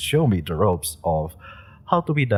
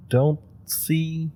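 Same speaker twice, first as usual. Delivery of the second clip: ordinary talk, nothing marked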